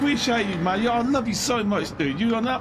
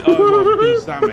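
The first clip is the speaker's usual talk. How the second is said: high and loud; excited; 13 semitones higher, 11 dB louder than usual. Real, not acted. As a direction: greet it with delight. Reacting to donation.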